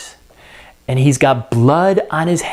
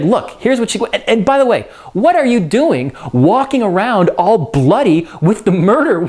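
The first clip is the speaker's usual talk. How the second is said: higher, hurried wife giving orders; bossy Lady Macbeth; 6 semitones higher than usual. Acted. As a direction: take charge like an exasperated wife. Teacher voices Lady Macbeth ordering.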